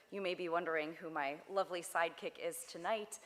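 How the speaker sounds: normal talking voice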